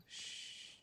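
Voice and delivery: soft unvoiced shush; breathy hush to keep quiet; hushing shh. Real, not acted. Shushing to not startle bird.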